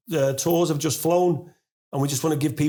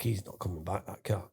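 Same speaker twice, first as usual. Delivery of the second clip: low, quiet, giving up on it; resigned; 6 semitones lower, 11 dB quieter than usual. Retold or imitated. Owner recalling giving up on his missing cat, low and resigned. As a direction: mutter it low and softly, resigned.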